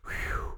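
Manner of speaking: acted "whew" of relief; imitated long, relieved breath out; relieved exhale. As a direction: blow out a mock sigh of relief. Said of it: "felt so comfortable and so relieved".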